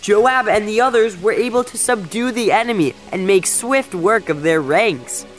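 high-pitched, exultant messenger; gleeful declaration. A narrator's reading. Messenger bringing the king news of victory, gleefully declaring. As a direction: proclaim the victory with glee, stretching the opening. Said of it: "waving and jumping with glee".

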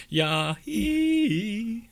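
"Yahoo" turned into a high, long yah-HEEE; playful parody name. Shown as a demonstration. Helping co-host with the film's email name, drawn-out high "Yahoo" as yah-heee. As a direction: stretch the end of "Yahoo" into a high eee.

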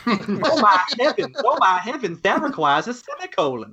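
high, effeminate mock-scandalized voice; effeminate Lindsey Graham. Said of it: "just very effeminate".

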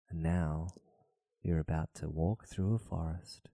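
hushed hypnotic guide voice; sleep story narrator. Pretend. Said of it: "Please stop talking like that".

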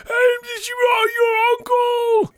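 high, drawn-out bad Irish accent; mock Irish accent. Showing off as the fake Irish uncle, high hammed-up Irish accent. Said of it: "I can do the best Irish accent".

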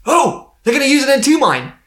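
high-pitched, alarmed outburst; mock panic. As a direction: act out a sudden panicked realisation. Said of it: "But she panics".

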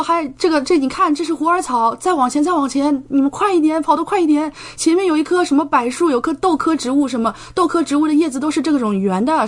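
teacher urging students up the mountain, excited and hurried. Retold or imitated. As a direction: hurry everyone on like a thrilled guide.

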